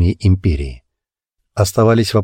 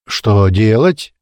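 put-on drunken yell; 6 semitones higher than usual. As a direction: bellow it like a drunk ready to brawl. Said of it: "пьяно заорал Клоп".

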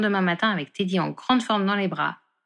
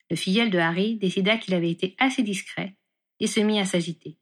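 usual speaking voice

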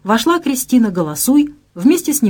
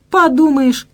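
dismissive; 8 semitones higher, 4 dB louder, slower than usual. Acted. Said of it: "заметила Вика".

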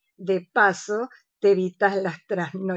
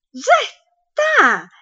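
sudden high cry of discovery; high delighted exclamation; excited eureka; 9 semitones higher, 6 dB louder, much slower than usual. Acted. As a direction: got it, burst out. Remembers the song suddenly.

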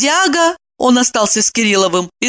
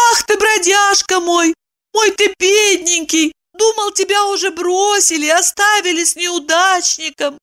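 high-pitched lisping pet talk; cooing baby talk; 12 semitones higher than usual. A narrator's reading. Woman comforting her frightened dog, cooing. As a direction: coo over a poor little doggie. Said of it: "она засюсюкала".

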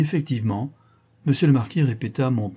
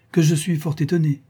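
higher, louder show of astonishment; mock indignation; 6 semitones higher, 5 dB louder than usual. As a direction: play the displeased father. Father feigns reproach before marquis. (étonnement indigné, joué)

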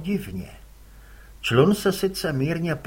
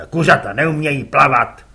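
louder gruff curt reply; gruff father; 10 dB louder than usual. Acted. Father answers his son curtly, gruff.

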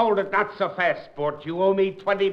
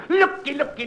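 barker call; 7 semitones higher than usual. Acted. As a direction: pull in the crowd with a shout. Barker drawing in the crowd.

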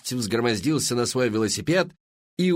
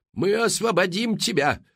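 shouting; 10 semitones higher than usual. A narrator's reading. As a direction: call out across the distance. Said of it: "крикнул Уилбери".

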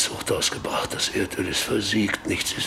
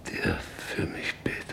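pleading whisper; 5 dB quieter than usual. Acted. Dying father whispers to his daughter Britta for prayer. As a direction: beg softly with the last breath.

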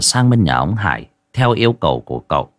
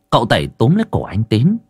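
ordinary delivery, nothing marked

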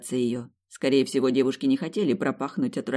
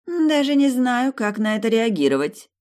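slow, higher, whiny stretched words; capricious drawl; 9 semitones higher, 6 dB louder than usual. A narrator's reading. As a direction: drawl it like a spoiled princess. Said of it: "капризно протянула она".

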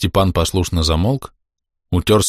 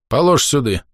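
commanding; 6 semitones higher than usual. Acted. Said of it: "велел Захарий".

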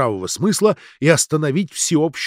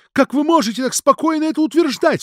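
very high, louder indignant outcry; outraged cry; 5 dB louder than usual. A narrator's reading. Ksenia cries out against talk of suicide, outraged. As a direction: cry out in protest. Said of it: "вскричала Ксения".

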